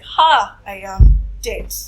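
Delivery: loud, drawn-out mock horror; hammed-up gasp of a mother's dismay, very loud; mother's imagined shock. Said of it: "she's going to be like".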